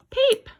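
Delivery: very high, loud chick peep; tiny baby chick chirp. Shown as a demonstration. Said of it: "chick says peep".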